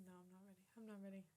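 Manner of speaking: very soft, hushed reluctant dog voice; voicing the dog. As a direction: quietly refuse like a sulking dog. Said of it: "She said".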